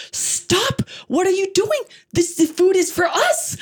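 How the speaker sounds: high-pitched mock outrage; hammed-up indignant father bursting in; angry father. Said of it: "And the father gets angry".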